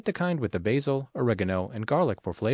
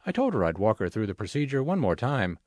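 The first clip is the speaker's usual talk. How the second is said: ordinary delivery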